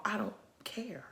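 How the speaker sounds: whispered under the breath. Real, not acted. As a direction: drop to a defiant whisper. Defiant aside, unvoiced.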